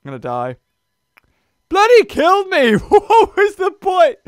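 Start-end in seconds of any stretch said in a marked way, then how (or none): 1.7-4.2 high, loud outraged yell; outraged shout. Real, not acted. Player just killed in the game, yelling in outrage. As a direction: cry out at being killed.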